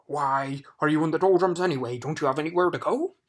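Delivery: loud, gruff demand; loud scolding. Acted. Watchdog scolding stuck Milo, loud and gruff. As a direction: boom the questions at him.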